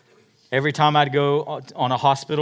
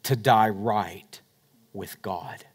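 slow; 3 semitones lower, much slower than usual. Real, not acted. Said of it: "You and I have an opportunity one time".

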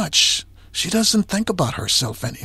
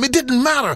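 higher It didn't matter; climactic refrain; 6 semitones higher than usual. Real, not acted. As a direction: land It didn't matter firmly. Refrain closes.

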